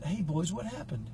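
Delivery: put-on soft, quiet, calm question to scared boys; calm and gentle. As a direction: ask softly so the boys aren't frightened. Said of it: "I was really calm and cool".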